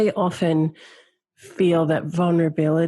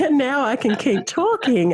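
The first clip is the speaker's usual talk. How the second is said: talking through real laughter, pitch jumping up; laughing through tears; 4 semitones higher than usual. Real, not acted. Telling the interviewer she can now talk while laughing. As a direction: laugh as the words tumble out. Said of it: "I actually started laughing".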